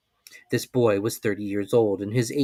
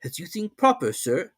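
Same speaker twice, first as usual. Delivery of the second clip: higher, unruffled servant reply; reply coolly, voiced higher; 8 semitones higher than usual. A narrator's reading. Servant accepting the voyage coolly, higher voice. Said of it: "coolly replied Conseil".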